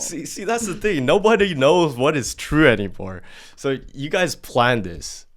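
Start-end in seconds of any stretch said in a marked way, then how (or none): 0.0-3.2 exasperated. Real, not acted. Host exasperated at conflicting stories.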